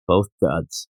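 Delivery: low, disgusted aside; low grumbled verdict. Shown as a demonstration. Verdict repeated, first low.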